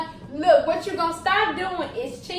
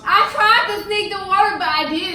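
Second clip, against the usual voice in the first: laughing; 5 semitones higher, 8 dB louder than usual. Real, not acted. Laughing confession in argument.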